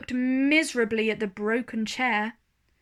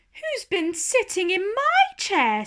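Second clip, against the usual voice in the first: much higher middle bear voice; medium bear voice; 8 semitones higher, 3 dB louder than usual. A narrator's reading. Medium bear asks about her chair, high voiced. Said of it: "said the medium bear".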